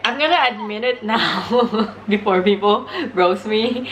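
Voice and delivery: normal talking voice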